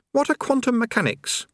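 put-on high young boy's voice; lightly high-pitched youth voice; boy Eric. Eric asks.

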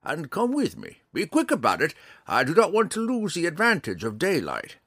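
high, loud ranger hurrying him on; urgent, commanding old mentor. A narrator's reading. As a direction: hurry him along in a character voice. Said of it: "Montaleo said".

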